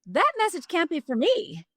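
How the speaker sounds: high, disbelieving protest; incredulous. Retold or imitated. Telling herself the vision wasn't hers, incredulous.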